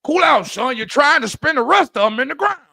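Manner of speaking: loud, high, easygoing advice; Morgan Freeman. Retold or imitated. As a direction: tell him like a passing wise man. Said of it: "Morgan Freeman coulda got that phrase off".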